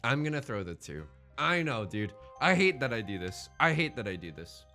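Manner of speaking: ordinary delivery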